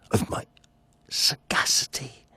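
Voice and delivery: soft whisper; whispered self-admiration. Acted. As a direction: relish it in a hush. Savoring his own cleverness.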